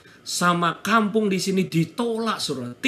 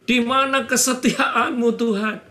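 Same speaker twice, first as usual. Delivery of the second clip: retold crying plea to God, high-pitched; thin-edged, higher acted lament in prayer; tearful prayer; 6 semitones higher than usual. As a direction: plead upward as if close to tears. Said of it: "saya nangis di situ".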